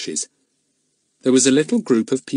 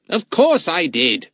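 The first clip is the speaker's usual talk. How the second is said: impatient; 7 semitones higher, 4 dB louder, slower than usual. A narrator's reading. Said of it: "said Fatty impatiently".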